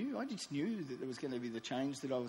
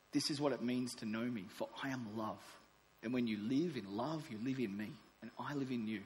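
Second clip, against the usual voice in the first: God's love letter; 4 semitones lower than usual. Acted. God writing to His precious child, gentle and tender. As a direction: read it like a father's tender letter.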